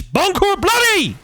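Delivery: hammed-up high squealing yell; mock yell in a put-on voice; mock outburst. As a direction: blurt it out in a silly high shriek.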